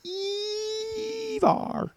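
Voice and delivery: Evar said very high and drawn out, ee-var; cartoon squeak on the word. Shown as a demonstration.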